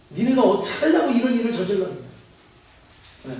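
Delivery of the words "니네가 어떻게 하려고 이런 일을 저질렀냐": quick, sharp scolding of his sons; a retold father's rebuke, mildly heated. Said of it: "야곱이 막 아들들에게 야단을 칩니다". (아들들을 꾸짖는 아버지, 약간 격앙된 목소리)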